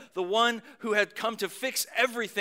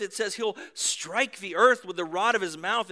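ordinary talk

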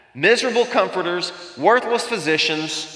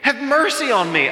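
rising desperate plea; 9 semitones higher than usual. Retold or imitated. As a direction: let the plea climb into a cry. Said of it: "Job is just weeping and he's crying out".

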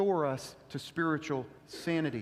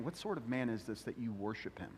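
hushed question; 8 semitones lower, 10 dB quieter than usual. Retold or imitated. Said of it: "left asking the question".

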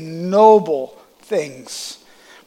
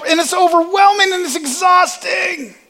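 pitched way up, strained and exasperated; overwhelmed; 12 semitones higher, 3 dB louder than usual. Acted. Preacher describing pleasing everyone, worked-up exasperation. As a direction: build to a high, overwhelmed outburst.

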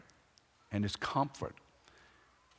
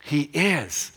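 loud, drawn-out preacher's declaration; ringing pulpit emphasis; emphatic proclamation; 8 semitones higher, 16 dB louder, much slower than usual. Real, not acted. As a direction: proclaim it at full volume, higher and slower. Preacher proclaims God's presence.

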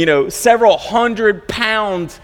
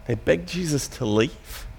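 much softer and lower, nearly under the breath, on "They begged Jesus to leave"; quiet astonished repeat; 7 semitones lower, 10 dB quieter than usual. Shown as a demonstration. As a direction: drop to a hushed, disbelieving repeat. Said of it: "a pretty surprising turn of events".

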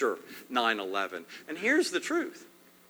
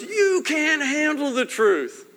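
high, slow, loud courtroom outburst; Jack Nicholson impression; 14 semitones higher, 6 dB louder, slower than usual. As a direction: fire it back like the famous film line. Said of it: "And Nicholson goes".

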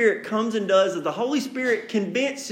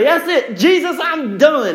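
exasperated outburst; 8 semitones higher, 7 dB louder than usual. Retold or imitated. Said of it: "And you can say".

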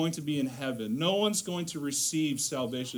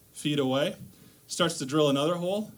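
ordinary talk, nothing marked

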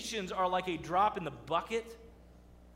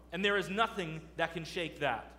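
usual speaking voice

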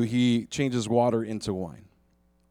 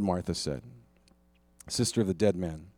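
ordinary talk

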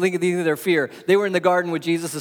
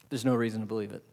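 nearly a whisper to land it; hushed; 6 semitones lower, 8 dB quieter, much faster than usual. Acted. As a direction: drop right down, much softer and lower. Hushed conclusion.

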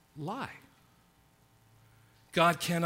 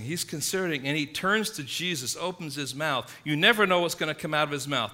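ordinary talk

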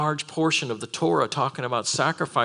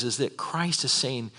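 ordinary talk

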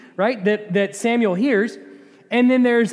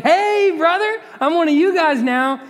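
high, chummy, overfriendly; mock buddy-buddy Agag; Agag's cheerful greeting; 8 semitones higher, 4 dB louder than usual. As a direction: play it like an old pal. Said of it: "He's like".

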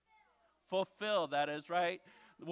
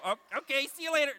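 high, loud puppet farewell; Bones; 7 semitones higher, 6 dB louder, faster than usual. Pretend. Puppet taking leave of his ventriloquist, high puppet voice. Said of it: "No, Bones".

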